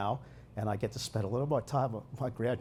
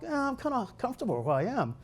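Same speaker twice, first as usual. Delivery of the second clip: reluctant self; 11 semitones higher than usual. Retold or imitated. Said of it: "I'm like".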